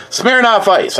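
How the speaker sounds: drawn-out showy announcer. Pretend. Vlogger introducing drink to viewers, mock announcer. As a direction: present the drink like a game show host.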